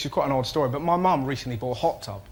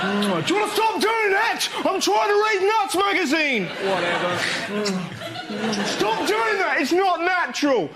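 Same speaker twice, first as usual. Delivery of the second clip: outraged lad on plane; 12 semitones higher than usual. Acted. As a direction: shriek at the kissing couple in outrage. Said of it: "Ramon, let's do the bad thing".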